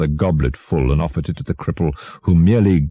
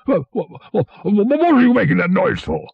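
high, stammering outrage; sputter, then demand an answer furiously; furious king. A narrator's reading. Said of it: "turning furiously to the dwarf".

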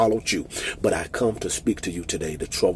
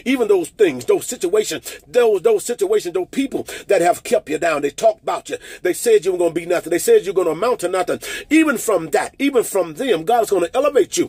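higher, driving declaration, lines rushing faster toward the end; fervent preaching; 12 semitones higher, 7 dB louder than usual. Acted. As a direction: preach at full force, calling them out of the pain. Fervent declaration over congregation.

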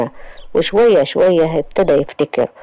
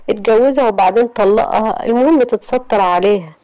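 put-on higher, insistent young woman; Yasmin's urgent voice; 7 semitones higher than usual. Yasmin insisting Ahmed marry the girl, higher and urgent. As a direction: push him hard, voice raised and higher.

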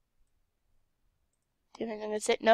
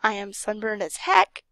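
ordinary talk

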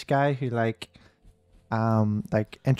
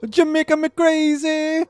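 put-on Jamaican accent. Joking Jamaican puns.

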